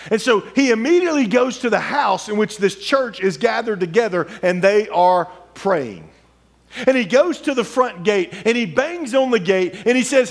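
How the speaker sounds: high, ringing sermon storytelling; preaching at full force. Acted. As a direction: drive the Bible story to the church. Preached retelling continues.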